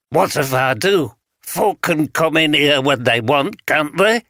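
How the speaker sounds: put-on suspicious vagrant in a light broad Yorkshire accent; a hint of broad Yorkshire, wary and defensive. Wary vagrant answers the detective, lightly voiced broad Yorkshire. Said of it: "spoke in the broad Yorkshire accent".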